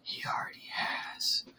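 hushed, voiceless reply; dramatic whisper. Acted. Suitor looking Draco in the eye, whispering. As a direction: whisper it like a secret reveal. Answers Draco in his proposal story.

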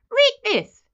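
high, loud, urgent plea; desperate cry. Acted. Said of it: "cried Benjamin desperately".

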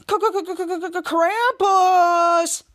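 rapid stutter into a long drawn-out cry; hammed-up villain shout; Krampus catchphrase. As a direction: stammer the K's, then stretch the name loud and high. Imitates a stuttered monster catchphrase.

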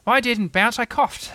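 high-pitched, indignant protest; peeved little outburst; cross. A narrator's reading. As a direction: insist crossly, pitch jumping up. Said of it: "said Tigger crossly".

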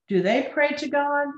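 put-on a child piping up high, asking; a small child pipes up, curious; piped. A narrator's reading. Child asks the father at bedtime, piping high. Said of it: "piped one".

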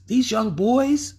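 high, slow mock boy voice; hint of a teenage boy; mock boyish voice. Describing the rowdy boys.

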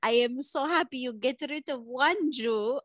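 deadpan put-on Eastern European accent; Svetlana accent. Svetlana greets the podcast hosts in a thick put-on accent. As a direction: play Svetlana, blunt foreign guest.

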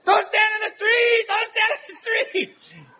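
high, loud, frantic group warning shout; shouted with a laugh breaking through the second one; mock yell. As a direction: bellow it like a crowd warning someone off the road. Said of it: "ten mentally challenged people yelled".

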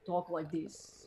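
slow, soft Russian accent demo. Shown as a demonstration. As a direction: drawl it slowly in a thick Russian accent. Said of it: "I love Russian".

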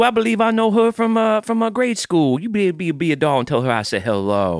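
bow and fuss like an antebellum host; Southern gentleman accent. Acted. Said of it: "here in the antebellum South".

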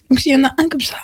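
whispering. Real, not acted. Showing snack, whispered.

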